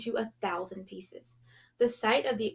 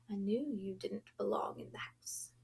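much softer, a scornful whisper; baleful whisper; 9 dB quieter than usual. A narrator's reading. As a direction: hiss it under the breath with contempt. A silent baleful look voiced.

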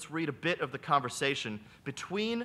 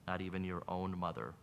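soft, sorrowful answer; sad retort; 8 semitones lower, 7 dB quieter than usual. Acted. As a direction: say it with quiet sorrow. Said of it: "Sadly, the brother retorts".

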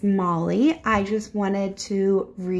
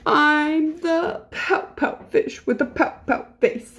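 higher, rhythmic pouty refrain; glum pouting fish; 5 semitones higher than usual. Acted. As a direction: chant it like a sulky little fish. Voices the gloomy fish's reply.

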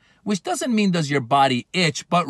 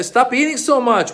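scolding; 8 semitones higher than usual. Pretend. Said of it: "like, uh".

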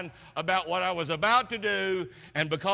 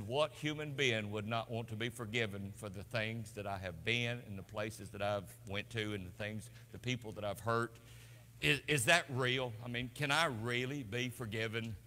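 hushed prayer; 8 semitones lower, 8 dB quieter than usual. Retold or imitated. Retold desperate prayer to God.

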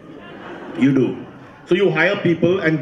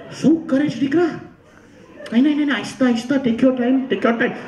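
high-pitched coaxing voice; dad indulging a dog; 8 semitones higher than usual. Retold or imitated. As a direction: imitate a father sweet-talking a stray dog. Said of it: "He'd stop".

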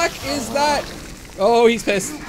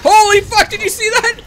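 high, loud startled outcry; shocked yell; 11 semitones higher, 10 dB louder than usual. Real, not acted. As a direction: blurt it out in real shock. Startled by a monster in game.

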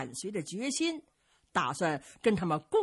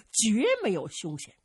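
usual speaking voice

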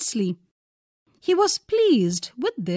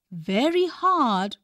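plain reading voice

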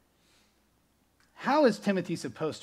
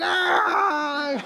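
high, loud cheering yell; excited shout; 13 semitones higher, 8 dB louder than usual. Retold or imitated. Crowd yelling as the kid holds the chair, a cheering whoop. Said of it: "we're like".

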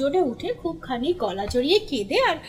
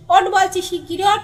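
angry order; 4 semitones higher, 6 dB louder than usual. A narrator's reading. Old man ordering while beating him.

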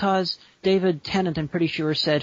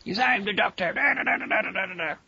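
higher-pitched, hammed-up Scottish impersonation; Peter Capaldi; 5 semitones higher than usual. Podcaster showing co-host the new Doctor, hammed-up impersonation. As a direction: proclaim the role, then chant the theme tune. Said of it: "an impersonation of Peter Capaldi as the Doctor".

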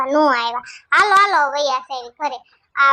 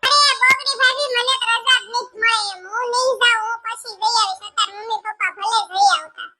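high, put-on voice of a fretting daughter; young daughter voice; 6 semitones higher than usual. Daughter complaining to her mother about the scolding, high voice. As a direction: play the daughter, pitched well up, a little tearful near the end.

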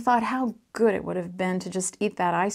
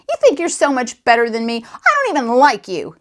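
raised, louder angry voice; acted boyish outburst, pitched up and loud; angry holler; 4 semitones higher, 7 dB louder than usual. A narrator's reading. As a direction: holler it at a friend in a temper. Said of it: "he hollered".

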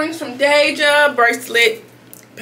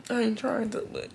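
whisper; 10 dB quieter than usual. Real, not acted. Confessing to partner, voice drops.

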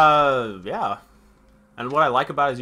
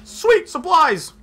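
excited cheer; 8 dB louder, much slower than usual. Real, not acted. Finding supplies in game.

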